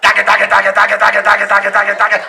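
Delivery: steady low monotone drum-roll chant; mimed drum roll. Shown as a demonstration. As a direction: rattle out a drum roll by mouth. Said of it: "du machst so".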